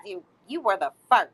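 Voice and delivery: ordinary talk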